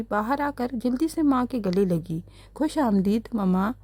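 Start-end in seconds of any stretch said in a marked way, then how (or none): none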